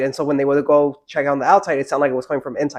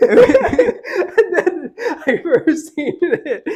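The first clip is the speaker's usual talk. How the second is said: laughing; 13 semitones higher, much slower than usual. Real, not acted. Laughing while telling ghost story.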